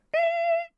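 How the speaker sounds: high, sustained squeal of a noise; imitated warning noise. Driver describing the warning never heard, imitated squeal. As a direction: imitate a loud high screech, held steady. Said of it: "not even a".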